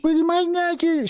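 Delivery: high-pitched mock newscaster; mocking mimicry. Mocking the clip's newscaster line.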